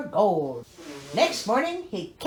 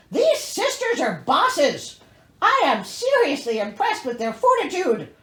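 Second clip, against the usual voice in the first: mock admiration; 4 semitones higher, 5 dB louder than usual. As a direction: cheer them on with irony.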